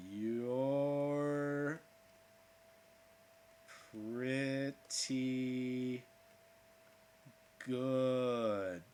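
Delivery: slow, drawn-out gruff quote; lightly voiced Snake, drawn out; Snake line. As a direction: stretch each word like the game character. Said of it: "he also says".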